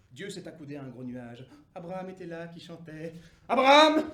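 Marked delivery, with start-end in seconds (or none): 3.5-4.0 grand cri d'appel, fort; appel tonnant. Acted. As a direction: lance le nom d'en haut. Dieu appelle Abraham. (Dieu appelle Abraham, d'une voix tonnante)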